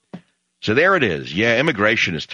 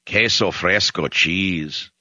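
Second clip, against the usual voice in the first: usual speaking voice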